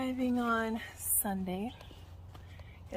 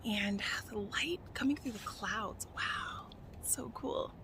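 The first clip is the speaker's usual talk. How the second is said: awed whisper; 2 semitones lower, 10 dB quieter, slower than usual. Real, not acted. Awed by the view.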